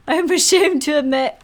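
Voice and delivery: laughing through a viewer's line. Real, not acted. Streamer reading chat to viewers, laughing. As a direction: giggle while reading it out.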